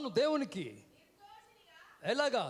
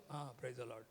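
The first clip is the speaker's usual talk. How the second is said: much lower and softer, a mumbled token "praise the Lord"; half-hearted reply; 10 semitones lower, 13 dB quieter, much slower than usual. Retold or imitated. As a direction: toss off "ఆ, praise the Lord" barely bothering. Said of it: "మొత్తం "praise the Lord" ఇవ్వం".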